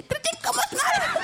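very high slow dazed Iki; very high slow mock voice after the hit; mimicking Iki. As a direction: stretch it out, squeaky and dazed. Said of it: "om han ikke siger".

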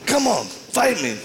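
hammed-up tough guy daring him; high mock taunt. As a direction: goad him to fight. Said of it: "He goes".

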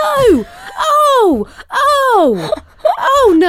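loud, high cries of horrified disbelief; aghast outcry. Real, not acted. Watching an embarrassing clip with a co-host, aghast. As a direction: wail in real shock at the sight.

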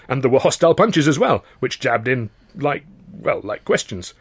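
ordinary delivery, nothing marked

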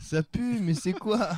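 high-pitched mock kid voice; pestering child. Child interrupting dad's conversation, pestering.

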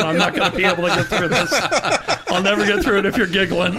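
high, rushed, giggly protest; trying not to laugh. Real, not acted. Host telling giggling co-hosts he can't get through the story, laughing. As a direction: plead through real laughter, racing along. Cracking up while trying to read.

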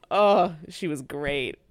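usual speaking voice

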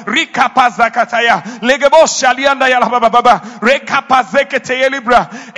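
loud, rapid tongues; speaking in tongues. Real, not acted. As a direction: pour out tongues in fervent prayer.

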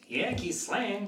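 high, overdone American twang; caricature Yankee accent. Shown as a demonstration. Director demonstrating a caricature Yankee accent to the actor. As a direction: play a cartoon Yankee. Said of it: "caricature instead of accent".

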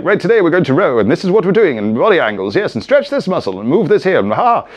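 brisk, loud, high instructor voice; snappy fitness presenter; on-point presenter. Retold or imitated. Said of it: "sometimes I'm very kind of on point and like".

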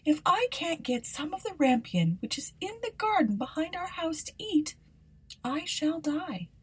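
high, fading plea of a wife pining away; a sighing, high wife who thinks she will die. A narrator's reading. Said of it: "she replied".

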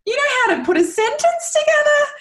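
high, fast, overjoyed cry of wonder; overjoyed, almost shouting in wonder; joyful disbelief. Retold or imitated. Mother reliving son's first sentences, high with joy. Retells joyful outburst at son.

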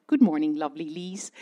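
a hint of a polite colleague being nice. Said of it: "sometimes they said".

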